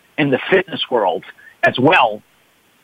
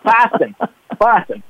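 Boston said higher as Bahstin, r dropped; Boston accent; 7 semitones higher than usual. Shown as a demonstration. [name] repeating Boston for host in his accent. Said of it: "Oh, Boston".

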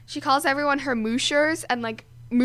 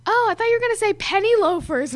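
mom voice; 9 semitones higher, 8 dB louder than usual. Retold or imitated. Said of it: "And she's like".

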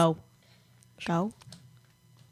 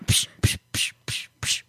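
teasing psh. Pretend.

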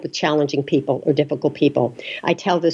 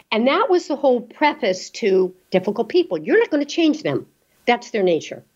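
ordinary delivery

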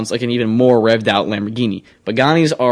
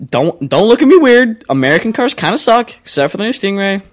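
higher, louder defensive outburst; defensive protest; 8 semitones higher, 4 dB louder than usual. Real, not acted. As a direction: protest in a raised, high-pitched voice. Defending opinion to co-host's look.

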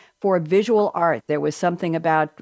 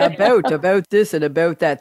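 mock Canadian accent; 4 dB louder than usual. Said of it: "am I hearing a Canadian twang in there".

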